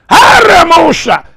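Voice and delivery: high loud cry in tongues; shouted tongues. Real, not acted. Pastor shouting in tongues over the church. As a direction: hurl the tongue out at full voice.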